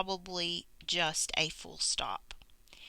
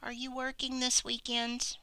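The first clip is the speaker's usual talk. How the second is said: "Are you working this weekend?" rising as a real question; question tune; 6 semitones higher than usual. Shown as a demonstration. Teacher modelling a question, rising question tune. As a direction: ask it, lifting toward the end. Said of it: "Stop that with a question mark".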